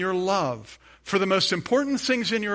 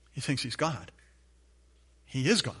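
usual speaking voice

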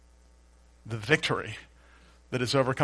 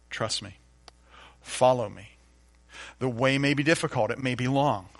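ordinary delivery

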